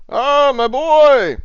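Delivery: loud, high, drawn-out hail; booming greeting. Acted. Barkeep greets a patron walking up, booming hail. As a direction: cry out a long, delighted welcome.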